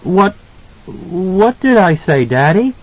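put-on high, slowed child's question; confused little boy. A narrator's reading. Boy asking his daddy, confused child voice. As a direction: ask as a bewildered small boy.

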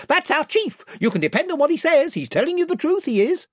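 high, gabbling yes-man; chorus of followers. A narrator's reading. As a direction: back up the chief in a high pitch. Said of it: "came the chorus".

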